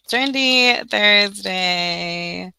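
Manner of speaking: long drawn-out, loud title call; show intro. Real, not acted. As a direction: stretch it out like a show opener. Announces the show's title.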